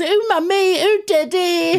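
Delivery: very high mock-kid squeal; loud, exaggerated little kid impression; child's voice. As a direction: call out like a small child to parents. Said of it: "it is usually from a child".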